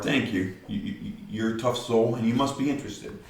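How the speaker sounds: ordinary talk, nothing marked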